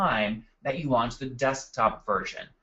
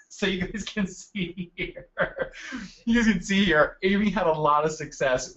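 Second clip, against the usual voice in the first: real laugh breaking into the words, higher; 5 semitones higher than usual. Real, not acted. Presenter splashed himself mid-webinar, talking through laughter. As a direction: try to carry on through the giggles. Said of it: "I'm laughing 'cause I just splashed water".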